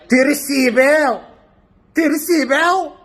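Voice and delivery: usual speaking voice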